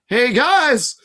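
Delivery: high, loud call; yelled. A narrator's reading. Grover yelling down to the others. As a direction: shout down from above. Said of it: "Grover yelled".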